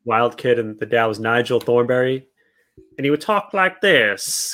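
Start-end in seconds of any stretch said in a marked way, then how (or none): 3.0-4.5 slow, drawled fake Australian accent; a hammed-up, half-hearted Australian accent; bad Australian accent. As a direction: imitate Nigel Thornberry's Australian accent badly. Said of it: "That's not an Australian accent".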